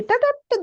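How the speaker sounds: high-pitched "ta-ta-ta" of answers arriving; rattled-off ta-ta-ta. Shown as a demonstration. Teacher showing children how the answer will come, high rattled syllables. Imitates answers coming after a prayer.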